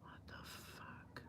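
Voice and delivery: breathless disbelieving whisper; shocked whisper. Real, not acted. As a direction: whisper it, stunned by what you see. Shocked at own watch history.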